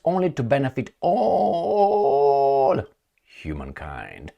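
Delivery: high, slow mock solemnity; mock pious. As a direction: drag it out like a sermon. Mocking treaty language.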